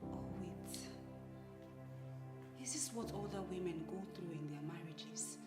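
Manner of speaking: quiet despair. Acted. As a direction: murmur it to yourself, heartbroken. Alone, despairing over her marriage.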